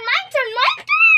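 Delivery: eager, high-pitched rising cry; excited. Real, not acted. Player claims the next turn in the game, excited.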